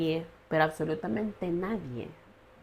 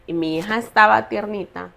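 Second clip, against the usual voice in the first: usual speaking voice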